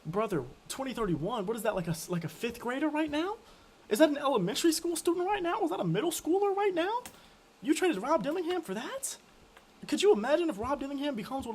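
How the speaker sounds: can't believe this trade, voice climbing; incredulous. Real, not acted. Incredulous rant about trade.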